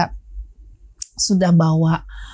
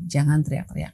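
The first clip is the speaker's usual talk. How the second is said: normal talking voice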